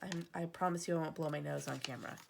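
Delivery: ordinary talk, nothing marked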